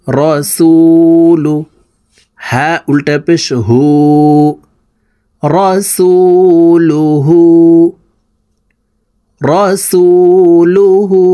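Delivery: slow model reading, rising; slow blended word. Shown as a demonstration. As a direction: lift and draw out the joined word. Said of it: "বানান করে বলে দিচ্ছি".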